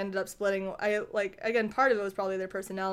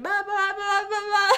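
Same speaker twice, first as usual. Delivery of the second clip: put-on much higher baby babble; baby noises; 10 semitones higher than usual. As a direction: babble like a toddler. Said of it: "make little baby noises".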